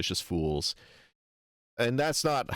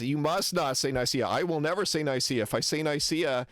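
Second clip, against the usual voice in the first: higher-pitched, imitating a pedant; retold adamant declaration, pitched up; mock insistence; 6 semitones higher than usual. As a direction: lay down the rule in mock earnest. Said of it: "To the point where he says".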